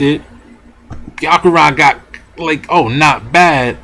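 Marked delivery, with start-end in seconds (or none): none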